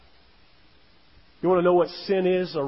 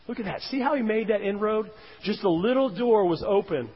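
ordinary talk

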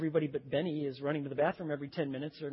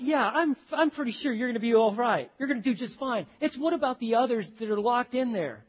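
mock usher; 7 semitones higher, 8 dB louder than usual. Mock usher reassuring, then concerned.

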